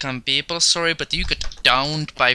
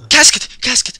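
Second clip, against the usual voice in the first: excited shout; 10 semitones higher, 11 dB louder than usual. Real, not acted. Shouting at game.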